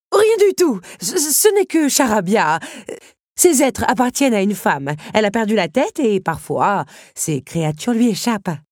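high, loud dismissal settling into explanation; agitated countess. Acted. Countess dismissing the beetles' message, agitated. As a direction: brush it off too forcefully, then explain.

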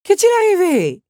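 high, loud alarmed question; dramatic dismay; shocked exclamation. A narrator's reading. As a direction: cry out wanting to know at once. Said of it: "s'exclama la comtesse".

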